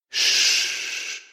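hushed, tender cooing; soft cooing. A narrator's reading. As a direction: soothe a crying child. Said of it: "I cooed softly".

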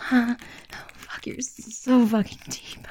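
ordinary talk, nothing marked

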